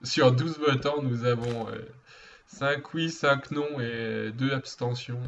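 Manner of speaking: measured, slowed mock vote announcement; mock UN announcer. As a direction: proclaim the tally like an assembly official. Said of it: "c'est à l'onu". (annonce officielle parodique, posément)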